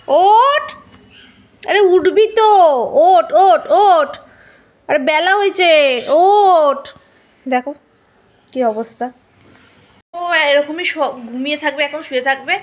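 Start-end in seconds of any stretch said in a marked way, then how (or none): 0.1-6.8 high, loud, insistent get up; loud wake-up call. Real, not acted. Owner shouting at the lazy pet that it's late, loud insistent call. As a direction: rouse a sleepy pet from across the room.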